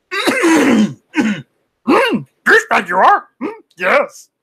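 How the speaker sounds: high, loud creature impression; Yoda. Streamer showing chat the only voice he can do, Yoda impression. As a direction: croak it out high, with little hums between phrases. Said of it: "mimicking with a voice is Yoda".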